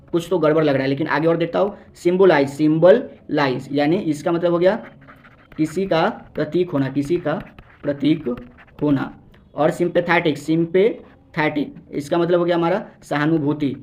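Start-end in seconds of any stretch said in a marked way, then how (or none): 2.5-3.7 symbolize drawn out slowly, syllable by syllable; dictating symbolize slowly to the class; slow, clear demo. Shown as a demonstration. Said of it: "teacher repeats word slowly". 10.4-11.8 sympathetic drawn out slowly and clearly; teacher spelling out sympathetic by ear, slowly; slow, careful demo. Shown as a demonstration. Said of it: "teacher repeats word slowly".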